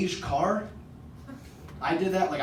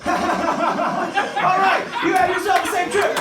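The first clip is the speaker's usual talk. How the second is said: laughing sendoff; 11 semitones higher, 6 dB louder than usual. Retold or imitated. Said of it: "He goes".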